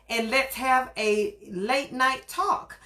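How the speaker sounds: show host intro. Acted. Host's drawn-out show intro.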